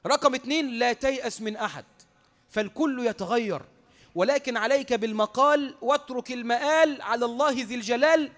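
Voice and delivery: slow, ringing sermon maxim; preacher hammering home a memorised saying; emphatic preaching. Real, not acted. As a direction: declaim it in measured, drawn-out phrases.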